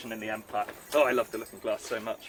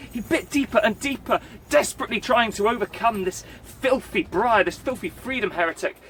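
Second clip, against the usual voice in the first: building excitement; 7 semitones higher than usual. A narrator's reading. Storyteller building to the climax of the dog story, rising excitement. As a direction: build the tension line by line.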